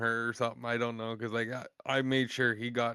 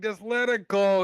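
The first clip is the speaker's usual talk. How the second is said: loud, high, drawn-out holler; slow, hollered cowboy encouragement; cowboy in next stall; 8 semitones higher, 8 dB louder than usual. Retold or imitated. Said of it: "he was a fucking cowboy".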